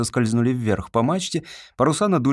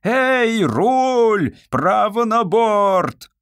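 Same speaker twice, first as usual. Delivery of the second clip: high, loud yell over the storm; shouting over wind; 9 semitones higher, 5 dB louder, much slower than usual. A narrator's reading. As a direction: call out against the gale, slowly. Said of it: "прокричал Цыбину сквозь ветер".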